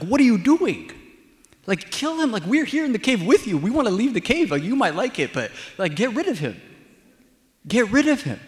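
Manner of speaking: high, loud, incredulous protest; exasperated urging. Retold or imitated. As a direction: push him impatiently to get on with it. Men scolding David, urging him.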